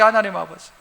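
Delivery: 하나님 아버지 said soft and low; a quiet humble prayer. Shown as a demonstration. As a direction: murmur 하나님 아버지 gently. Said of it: "하는 게".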